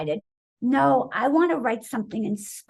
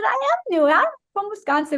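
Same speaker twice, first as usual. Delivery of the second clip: eager reply; 9 semitones higher than usual. Retold or imitated. Eager new girl replies.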